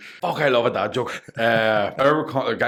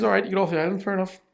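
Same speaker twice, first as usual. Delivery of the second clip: a much higher, weary genie giving in; exasperated genie; 11 semitones higher, faster than usual. Acted. Genie giving up on the daft third wish, voice jumping high. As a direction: throw up your hands as the genie.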